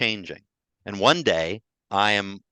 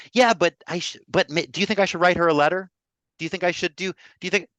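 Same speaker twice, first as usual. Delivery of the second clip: high, fast, louder pleading questions; anxious pleading; 10 semitones higher, 5 dB louder, faster than usual. Retold or imitated. [name] mocking his own frantic self, high and rushing. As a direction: fret out loud, rushing each question.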